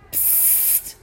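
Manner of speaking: soft, voiceless shush; whispered psst; hushing psst. Acted. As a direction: hush a noisy friend with a long hiss. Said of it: "machte Mama Mu".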